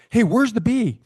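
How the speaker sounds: high, loud retold call; mock panicked shout. Frightened partner calls to the officer, mock panicked shout. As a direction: call out urgently, imitating the partner.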